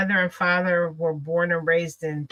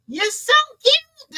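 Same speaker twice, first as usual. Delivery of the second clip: very high, slow, gushing; sweet high praise; 4 dB louder, slower than usual. Retold or imitated. Southern strangers gushing over her, high and slow. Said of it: "That's what they say".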